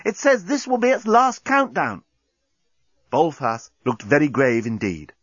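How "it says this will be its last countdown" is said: high-pitched alarmed report; urgent cry. A narrator's reading. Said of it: "cried Nettie".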